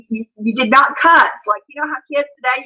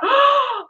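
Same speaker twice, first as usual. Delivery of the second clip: high mock-shocked gasp, "oh"; scandalized gasp; 12 semitones higher than usual. Mom seeing daughter's rude gesture, mock scandalized gasp. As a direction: gasp as an appalled mother.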